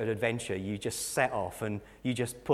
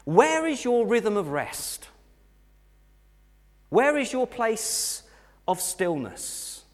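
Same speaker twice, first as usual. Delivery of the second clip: high-pitched, emphatic sermon delivery; raised preacher's voice, punching key words; impassioned preaching; 10 semitones higher, 6 dB louder than usual. Real, not acted. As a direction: drive the point home to the congregation. Preaches at full force.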